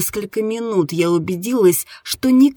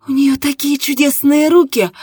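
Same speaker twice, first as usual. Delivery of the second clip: higher, louder exclamation; delighted moaning; 6 semitones higher, 6 dB louder than usual. A narrator's reading. Woman praising the narrator to her partner, delighted moan. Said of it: "громко застонала".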